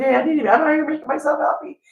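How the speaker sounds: upbeat mock carefree person; cheerful invite. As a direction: wave everyone aboard, carefree. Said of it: "They just think".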